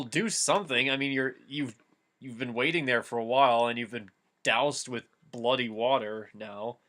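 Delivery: normal talking voice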